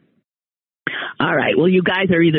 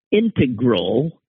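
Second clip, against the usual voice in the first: "integral" slowly with the stress on IN; first-syllable stress; slower than usual. Shown as a demonstration. Reading a man's pronunciation complaint, "integral" stressed on the first syllable. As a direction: say "integral" as IN-tuh-grul, drawn out. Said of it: "emphasis on the first syllable".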